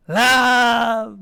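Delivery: loud, high, long-held yell; drawn-out playful shout. A narrator's reading. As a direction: bellow it like gleeful kids. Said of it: "the Azatoths yelled".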